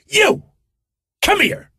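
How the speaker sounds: very high hollering impression; screamed summons. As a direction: yell it high across a room. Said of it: "he screams".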